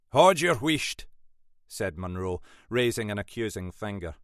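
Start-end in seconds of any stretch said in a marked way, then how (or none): 0.1-1.1 loud, raised-pitch rebuke; stern silencing. A narrator's reading. Boss interrupting sergeant's excuse, stern and loud. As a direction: cut them off sharply and forcefully.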